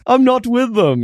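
high, exaggerated American accent impression; hammed-up fake American accent; mock American accent. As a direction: imitate a bad put-on American accent. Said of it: "trying to do his American accent".

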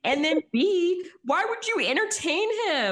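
can't believe what she's watching; exasperated disbelief. Real, not acted. Asking her co-host why Stassi entertains Jax, exasperated.

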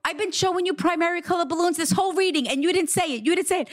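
put-on high, fast childish shouting; playful yelling boy; mock yell. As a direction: excitedly scold like a kid. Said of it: "your son is yelling".

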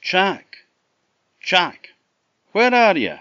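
high, loud calling across the river; shouting out. A narrator's reading. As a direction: call out for him, high and loud. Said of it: "she shouted out".

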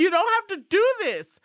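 high, loud mock plea; mock pleading. Fernanda begging Pam not to kill her, mock pleading.

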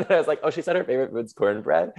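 fast, laughing speech. Real, not acted. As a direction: talk through laughter. Laughing while retelling.

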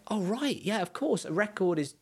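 high-pitched voicing of a dawning realisation; retold lightbulb moment, high voice; sudden realisation. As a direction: act out the penny dropping, pitched well up. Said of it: "seeing that and going".

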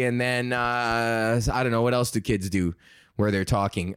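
ordinary talk, nothing marked

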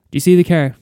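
loud and high into the phone. Retold or imitated. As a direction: ask it loudly down the line. Calling over the phone.

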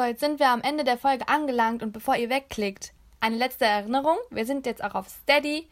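normal talking voice

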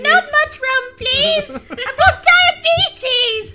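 put-on high, loud, very slow old lady; man doing granny voice. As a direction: drag it out like a frail granny. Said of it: "In an old lady voice".

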